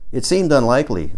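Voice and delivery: usual speaking voice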